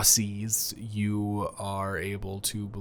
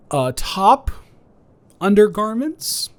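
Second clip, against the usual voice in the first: flustered squeak; 11 semitones higher, 8 dB louder than usual. Acted. Awkwardly offering clothes to guest.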